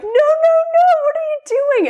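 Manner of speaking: high, loud squealing no, no, no; horrified protest. Retold or imitated. Wife squealing at husband to not make her come downstairs, shrill protest. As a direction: shriek it out like you're being dragged somewhere.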